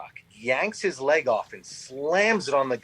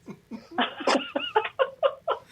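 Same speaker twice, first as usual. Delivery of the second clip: long, high laugh; real laughter. Real, not acted. Listeners picturing the only spare foot, long real laughter. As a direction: crack up at the image.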